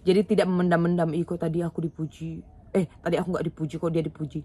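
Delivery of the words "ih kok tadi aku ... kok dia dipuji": soft, quick, petty grumbling to yourself; the wrong way: quiet, resentful complaining instead of saying it; sulky whining. Shown as a demonstration. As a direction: mutter it like you are nursing a grudge. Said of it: "Jangan, ya".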